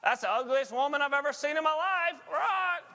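high squawky parrot voice. Acted. Parrot insulting the shopper, high squawky voice. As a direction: squawk it out like a rude bird. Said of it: "the parrot looks at the woman and goes".

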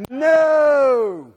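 NO held long, high and very loud; drawn-out emphatic NO. Shown as a demonstration. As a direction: lead the room in one long shouted NO. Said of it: "Say it with me".

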